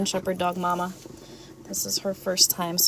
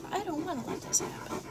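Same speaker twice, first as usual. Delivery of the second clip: voicing the dog; 7 semitones higher, 7 dB quieter, faster than usual. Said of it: "She's like".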